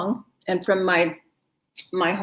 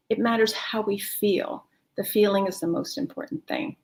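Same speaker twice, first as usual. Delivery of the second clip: whisper; 5 dB quieter than usual. Real, not acted.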